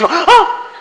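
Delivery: high, laughing, overdone "Oh!"; hammed-up gasped "Oh!"; mock surprise. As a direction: play the soap shock for laughs. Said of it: "something goes".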